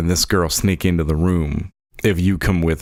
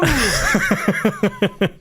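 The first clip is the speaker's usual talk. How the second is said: laughing; 12 semitones higher than usual. Real, not acted. Laughing after awkward flirting scene.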